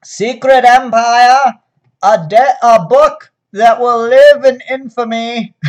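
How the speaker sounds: a hammy 1940s American radio speech, like FDR; FDR accent. Pretend. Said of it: "my horrible nineteen forties F D R accent".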